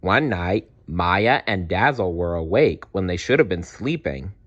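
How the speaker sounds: usual speaking voice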